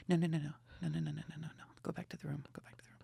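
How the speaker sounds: urgent hushed pleading fading to a whisper; hushed panic. Retold or imitated. To his camera crew, desperate hush into whisper. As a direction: shoo them away without being overheard.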